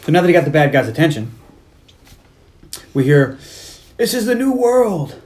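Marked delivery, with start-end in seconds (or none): 4.0-5.2 high, slow, drawn-out villain voice; mock villain proclamation. Bad guy announcing his new world, mock proclamation. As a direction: proclaim it high and slowly, hammed up.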